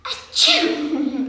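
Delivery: big, high acted "achoo"; loud pretend sneeze; fake sneeze. A narrator's reading. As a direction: burst out in a storybook sneeze. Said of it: "Peter sneezed".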